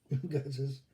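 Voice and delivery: ordinary talk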